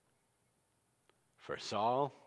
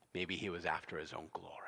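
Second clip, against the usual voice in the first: near-whispered, low and quick; hushed aside; 7 semitones lower, 8 dB quieter, faster than usual. Real, not acted. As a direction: drop to a knowing whisper. Preacher's hushed aside on Saul's motive.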